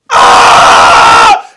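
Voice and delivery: loud burst of a mock-terrified scream; panicked scream. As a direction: scream and run for it. Bill flees from brother's attack.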